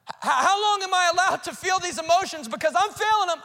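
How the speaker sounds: high-pitched, insistent questioning; pleading protest. Acted. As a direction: act out someone overwhelmed, asking for permission. Voices a griever's protesting question.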